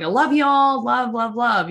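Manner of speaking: high, loud sing-song gush; imitating herself showering friends with love; telling friends she loves them, high and gushing. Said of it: "I'm like".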